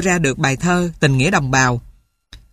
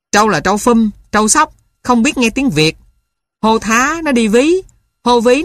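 shouting back; 4 semitones higher than usual. Acted. Tường shouting back at his uncle from the field, defiant yell. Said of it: "nó đứng giữa đồng la lớn".

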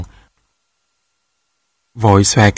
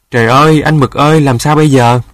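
louder, alarmed cry for help; panicked hollering; 3 semitones higher, 6 dB louder than usual. A narrator's reading. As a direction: wail out in storybook panic. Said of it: "Nó la bài hải". (la bài hải, hoảng hốt)

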